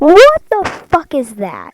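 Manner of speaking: high, slow shocked yell; shocked shout. Real, not acted. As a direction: shout out in disbelief. Monster appears on the wing.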